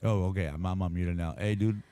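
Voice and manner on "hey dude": normal talking voice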